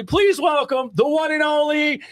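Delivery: long drawn-out hype call; announcer shout. Shown as a demonstration. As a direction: stretch the name out, huge. Ring announcer climax.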